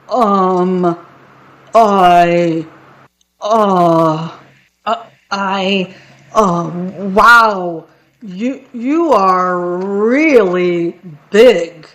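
hesitant troll, halting between words; daunted stammer. A narrator's reading. Troll faces the really big goat, intimidated stammer. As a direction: stumble through it, stretching the last words.

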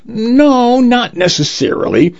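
ordinary delivery

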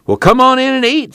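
high, hearty holler; calling invitation. A narrator's reading. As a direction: call him in to eat. Tom calls preacher in to eat.

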